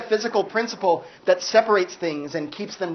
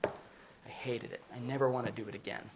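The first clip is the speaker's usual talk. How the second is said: lower, much softer, near whisper on I hated it; imagined bad review; 7 semitones lower, 16 dB quieter than usual. Imagined enlightened person hating it, hushed grumble. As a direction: mutter a bored complaint. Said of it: "talk about that in a way with".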